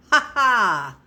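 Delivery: short triumphant cackle; a gleeful madman's "Ha ha!"; mad laugh. A narrator's reading. Killer gloating over his hidden crime, cackling.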